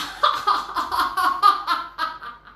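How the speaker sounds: loud, high belly laugh that won't stop; garlic laughing. Acted. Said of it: "laughing couldn't stop".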